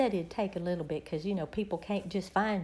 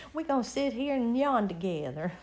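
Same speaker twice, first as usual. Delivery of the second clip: talking through giggles; amused, laughing words. Real, not acted. Laughing about yawning together.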